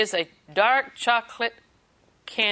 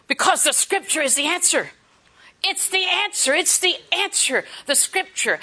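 the answer rings out higher each time; preaching emphasis; 7 semitones higher than usual. Real, not acted. Preacher urging her congregation, full fervour. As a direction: press the point home.

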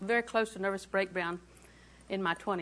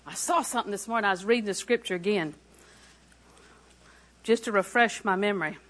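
ordinary talk

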